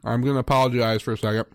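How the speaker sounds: talking while eating; words round a bite of candy bar; mouth full. Real, not acted. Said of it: "I'm eating a Snickers".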